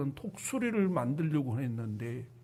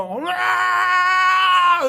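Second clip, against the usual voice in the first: high drawn-out scream; falling scream; 12 semitones higher, 9 dB louder, much slower than usual. Retold or imitated. Eaglet dropped from the nest, long high scream. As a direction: let out a long high scream as you drop.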